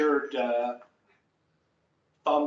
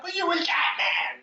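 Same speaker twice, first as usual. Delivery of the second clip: high shout; 4 dB louder than usual. A narrator's reading. Wailing actor.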